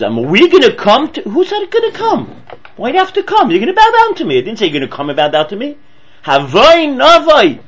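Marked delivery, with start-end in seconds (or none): 0.0-4.4 indignant father. Retold or imitated. Yaakov's rebuke voiced. 6.2-7.6 incredulous scoff. Retold or imitated. Acted incredulous father.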